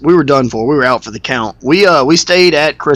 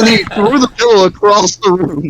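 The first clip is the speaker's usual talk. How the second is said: high, put-on indignant voice; imitating Nick outraged; 6 semitones higher than usual. Friend retelling the pillow discovery, high mock indignation. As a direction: play him fed up and indignant. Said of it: "He said".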